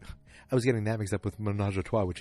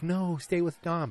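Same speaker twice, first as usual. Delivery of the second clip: mock pleading; 9 semitones higher, 4 dB louder, slower than usual. Mock plea to the character.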